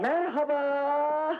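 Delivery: drawn-out greeting. Acted.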